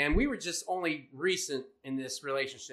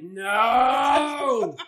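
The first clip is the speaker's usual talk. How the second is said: loud, high shout of dismay; mock cry; 7 semitones higher, 10 dB louder than usual. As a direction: cry out in despair. Shocked reaction.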